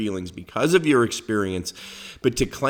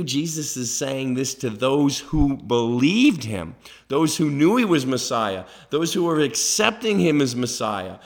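ordinary delivery

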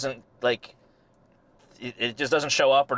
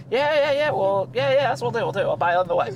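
high-pitched, repetitive brush-off in imitation; dismissive retold reassurance; 9 semitones higher than usual. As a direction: wave off the objection in a mock-casual high voice. Norm brushing off friend's objection.

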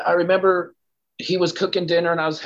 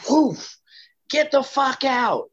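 much higher, slow mock yell; retold furious order; 10 semitones higher, much slower than usual. Melinda ordering Chris out, retold high angry command. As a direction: throw him out in her voice.

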